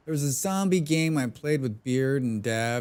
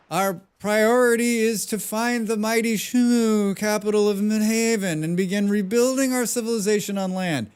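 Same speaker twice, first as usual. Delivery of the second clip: higher-pitched, hammed-up old man; 7 semitones higher, 3 dB louder than usual. Shown as a demonstration. Streamer showing off a character voice for game dialogue, old man voice. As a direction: put on an old man's voice for the dialogue. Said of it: "That's my old man voice".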